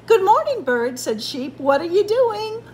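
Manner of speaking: high, loud cheery sheep; cheery greeting. A narrator's reading. Sheep calls good morning to Bird, cheery.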